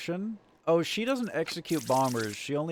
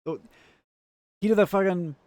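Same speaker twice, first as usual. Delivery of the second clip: ordinary delivery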